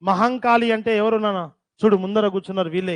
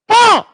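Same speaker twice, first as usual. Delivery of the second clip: a sharp, much higher yelp; sudden loud cry; 13 semitones higher, 12 dB louder than usual. Real, not acted. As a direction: burst out with one loud cry. Sudden outburst during the dictation. (ఒక్కసారిగా గట్టి అరుపు)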